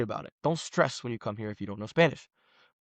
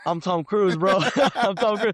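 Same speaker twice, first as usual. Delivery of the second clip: joking along; 7 semitones higher, 5 dB louder than usual. Retold or imitated. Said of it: "I'm like".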